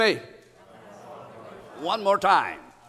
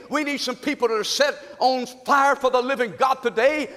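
ordinary delivery

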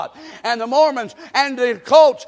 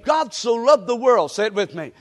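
ordinary delivery

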